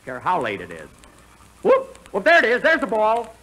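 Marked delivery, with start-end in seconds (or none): 1.6-3.3 excited whoop. Acted. Thinks he found the ball.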